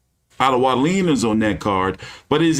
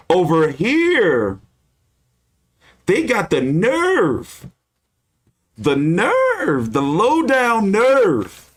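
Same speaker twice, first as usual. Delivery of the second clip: Don King impression; 6 semitones higher than usual. Boxing vlogger imitates a promoter overcharging fans, booming. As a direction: ham it up like a fight promoter preaching to the people. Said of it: "That was my Don King impression".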